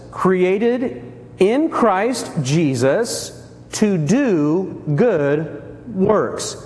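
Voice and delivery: each word drawn out, very slow; slow emphasis. Shown as a demonstration. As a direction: lean on every word, slowly. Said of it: "watch this".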